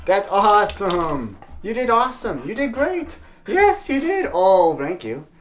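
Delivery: very high, gushing pet-talk; excited dog praise. Real, not acted. Owner congratulates his dog on doing great, excited high praise. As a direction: squeal praise at a good dog.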